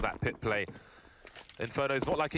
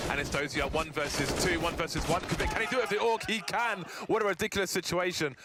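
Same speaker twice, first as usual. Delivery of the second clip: excited clutch call; 6 semitones higher than usual. Acted. Caster calling a one-versus-one clutch live, building excitement. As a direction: build to the win, then marvel.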